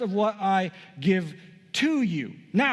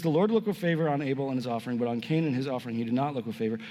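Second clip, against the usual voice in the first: fast, run-on scripture reading sinking in pitch; quick recap reading; 4 semitones lower, faster than usual. Real, not acted. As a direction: rattle through the verse as a recap. Quick re-reading of the same verse.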